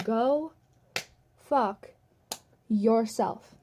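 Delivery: one word at a time, long pauses between; emphatic retort. Retold or imitated. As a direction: hit "Go", "fuck", "yourself" as three separate blows. Acted retort to the boss.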